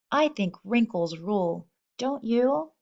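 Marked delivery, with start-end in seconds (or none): none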